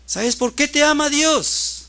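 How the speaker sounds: loud address building to a high shout; full-force preaching. Retold or imitated. Father asking son why God loves him, full-force preaching.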